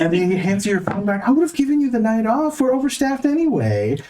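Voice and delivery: high-pitched flustered store manager; high nervous manager voice. Acted. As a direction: play a jittery, high-voiced boss.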